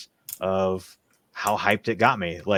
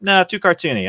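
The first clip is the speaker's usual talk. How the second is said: higher, louder, brushing it off; dismissive; 10 semitones higher, 7 dB louder than usual. Retold or imitated. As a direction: wave the show away as too cartoony. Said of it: "I was like".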